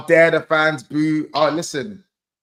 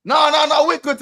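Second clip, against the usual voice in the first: high, louder schoolkid voice; pitched-up hurried reassurance; schoolkid covering up; 9 semitones higher, 6 dB louder than usual. Retold or imitated. Schoolkid telling the head teacher all is fine, high-pitched and insistent. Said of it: "going to the head teacher".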